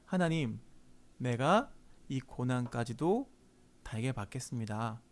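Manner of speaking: low, soft, submissive prayer; hushed acted prayer of surrender; humble acceptance. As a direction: yield meekly, slowing down.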